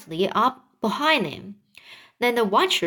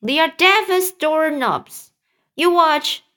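higher, louder boastful cry; cried out; 7 semitones higher, 5 dB louder than usual. Acted. Centipede boasts to the passengers, loud high cry. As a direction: brag loudly that they're deaf. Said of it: "he cried".

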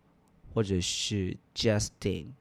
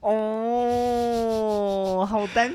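cooing delight; 10 semitones higher, 3 dB louder, much slower than usual. Real, not acted. Gushing over the shy guy.

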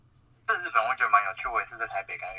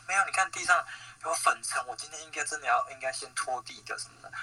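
put-on Japanese accent; 6 semitones higher than usual. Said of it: "很可爱的日本腔".

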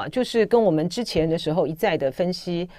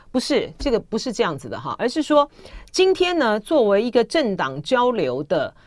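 usual speaking voice

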